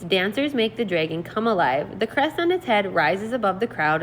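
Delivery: normal talking voice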